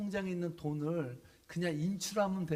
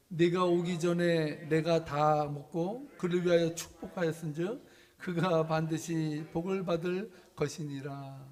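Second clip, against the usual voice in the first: scripture reading; 6 dB louder than usual. Acted. Reads scripture aloud.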